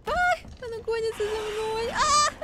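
high frightened cry while chased; scared, crying out as she runs; panicked. Real, not acted. Chased by monster, screaming. (испуганно, со вскриком)

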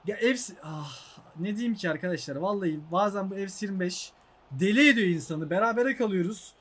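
usual speaking voice

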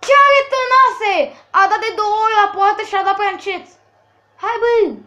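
high, loud exasperated complaint; fed up with the weak shooting; frustrated outburst. Real, not acted.

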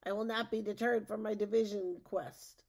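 yelled vow. A narrator's reading. Witch fends off the dog, mildly yelled vow. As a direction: declare it with a touch of defiance. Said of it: "Matilda yelled".

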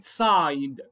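سايد stretched slowly on its long i. Shown as a demonstration. Teacher models the long i for pupils, slow. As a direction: draw out سايد so the long i rings. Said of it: "I بمعنى الاسم بتاعه اللي هو يعني long".

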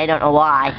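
casual mock Australian accent; put-on Australian accent. Vlogger showing viewers the ice, mock Australian accent. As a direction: play a chatty Aussie outdoorsman.

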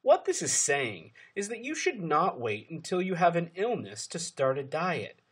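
usual speaking voice

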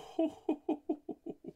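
high, hooting string of laughs; delighted laugh. Real, not acted. Breaker thrilled by the card, laughing. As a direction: laugh in quick high whoops.

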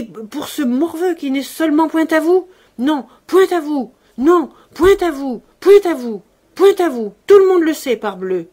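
loud, high, furious tirade; trembling with anger. A narrator's reading. Maddened servant confronting her master, in a choked, furious rant. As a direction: build from indignation to a full angry shout. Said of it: "la voix tremblante".

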